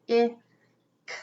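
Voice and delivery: ordinary talk, nothing marked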